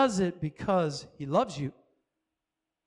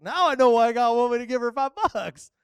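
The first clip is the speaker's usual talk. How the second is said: higher, louder wondering exclamation; amazed realization; 4 semitones higher, 5 dB louder than usual. Retold or imitated. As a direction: marvel out loud at the lesson. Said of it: "I was like".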